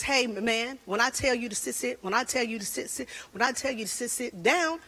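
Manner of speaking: stuck looping on "sit" until "sit down"; mock stutter, restarting from "when I tell you". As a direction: imitate a dad stuttering an order. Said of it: "My husband stutters".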